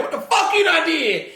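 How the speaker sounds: high, scolding cuss; mock cussing. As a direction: cuss the dog out in a squeal. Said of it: "walking around cussing".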